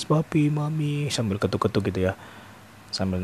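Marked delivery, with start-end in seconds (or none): 0.0-1.1 high, drawn-out mock whine for parents; imitated child's crying. Locked-out nephew calling his parents, mock crying. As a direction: wail it lightly, stretching each name. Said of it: "dia nangis-nangis".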